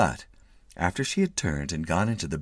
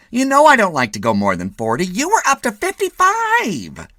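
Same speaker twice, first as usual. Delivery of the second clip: nagging wife; 14 semitones higher, 9 dB louder than usual. A narrator's reading. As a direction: scold him from the passenger seat. Wife scolds.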